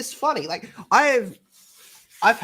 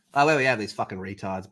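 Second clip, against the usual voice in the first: ordinary delivery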